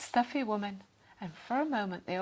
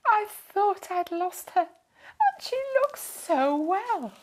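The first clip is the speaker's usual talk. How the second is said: tearful joy; 10 semitones higher, 5 dB louder, slower than usual. A narrator's reading. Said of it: "tears of joy".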